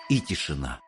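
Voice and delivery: usual speaking voice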